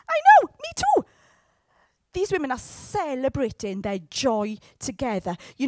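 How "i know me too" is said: very high, loud excited squeal; squealing Mary. Retold or imitated. Mary squeals back to Elizabeth, excited. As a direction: squeal it like a thrilled girl. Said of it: "And Mary's going".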